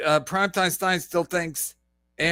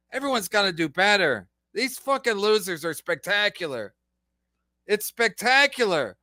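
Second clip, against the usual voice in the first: ordinary delivery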